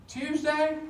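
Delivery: "Tuesday" high, as an unsure question; questioning tune. Acted. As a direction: say "Tuesday" like there's a question mark after it. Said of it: "say it like it's a question mark".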